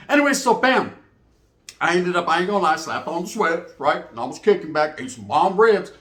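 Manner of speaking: usual speaking voice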